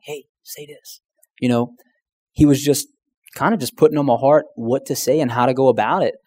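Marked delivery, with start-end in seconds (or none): 0.0-1.0 much softer, almost breathed aside; a hushed voice that isn't really in your ear; soft inner voice. Retold or imitated. Holy Spirit not speaking aloud.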